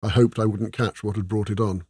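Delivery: ordinary delivery, nothing marked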